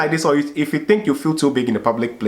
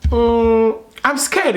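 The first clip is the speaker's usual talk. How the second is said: mock scared; 12 semitones higher, 3 dB louder, much slower than usual. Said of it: "I'm like".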